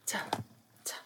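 T said as a short unvoiced t; t sound repeated. Shown as a demonstration. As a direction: tap the T sound. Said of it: "phonics sound demo".

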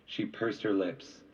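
plain reading voice

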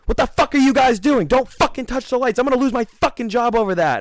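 high mock angry outburst; imitating angry waitress. Waitress yelling at the guys, high mock anger. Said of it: "she comes over and she goes".

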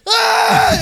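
high, loud panicked scream; startled scream. Retold or imitated. Roommates woken by the dropped bed, screaming. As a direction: shriek as if jolted awake. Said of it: "starts screaming".

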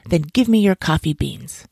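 ordinary delivery, nothing marked

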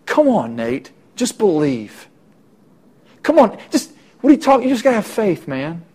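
high, rushed mock pushiness; exasperated friend hurrying him along; impatient nagging. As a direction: badger him to just believe. Pushy friend Philip mock-urged.

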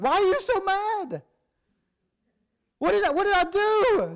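high, whiny mock bewilderment; hurt confusion. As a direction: play the baffled texter. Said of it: "you're like".

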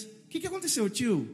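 put-on high, curious child voice; imitated little girls. Little girls to the father carrying his son, imitated curious question. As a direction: pitch it up like small girls asking. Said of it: "Aí umas menininhas".

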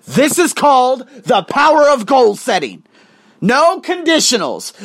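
loud, high motivational proclamation; emphatic coaching. Real, not acted. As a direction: drive the lesson home at full voice.

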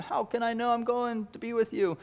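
high, pleading question as Thomas; Thomas asking. Acted. Preacher voicing Thomas, high and pleading. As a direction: plead like a doubting disciple. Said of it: "Thomas had asked him".

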